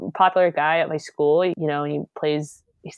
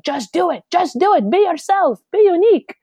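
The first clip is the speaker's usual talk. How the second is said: high-pitched imitation of a father's pep talk; urging father; 13 semitones higher, 4 dB louder than usual. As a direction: voice a dad urging on his kid, climbing higher. Voices her father's urging advice.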